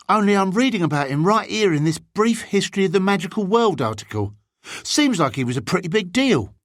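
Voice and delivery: put-on high-pitched, curious boy; bigger boy. Bigger boy explains his interest.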